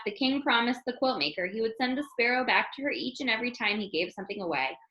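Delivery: plain reading voice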